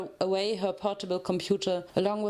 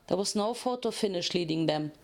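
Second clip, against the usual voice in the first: ordinary delivery, nothing marked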